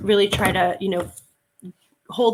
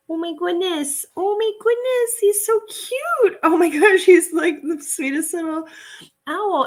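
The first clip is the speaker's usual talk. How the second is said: gushing over cute; 10 semitones higher, 5 dB louder than usual. Real, not acted. Reseller cooing over owl pendant, high delighted gushing. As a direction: coo over it like a tiny puppy.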